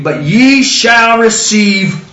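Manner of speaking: very slow, stretched-out scripture reading; emphatic proclamation. Acted. Preacher quoting the promise, slow emphatic proclamation. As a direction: draw out each word up to a big POWER.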